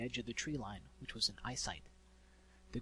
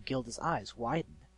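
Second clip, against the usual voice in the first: plain reading voice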